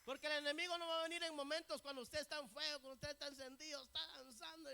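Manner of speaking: loud, high-pitched sermon delivery; full-force preaching. Real, not acted. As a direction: preach it out to the congregation. (predicación a toda voz)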